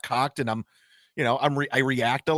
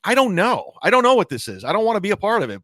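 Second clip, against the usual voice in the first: higher and louder, throwing up his hands in frustration; exasperated protest; 6 semitones higher, 5 dB louder than usual. Retold or imitated. Answering his aunt and cousins about his work, exasperated. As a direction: plead that you don't know what this is.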